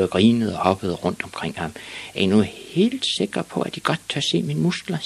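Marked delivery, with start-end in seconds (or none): 2.1-5.0 put-on high-pitched, unhurried kid voice; high child voice challenging the bullies; little boy Ivan. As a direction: ask it up high as a small boy. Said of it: "sagde Ivan Olsen".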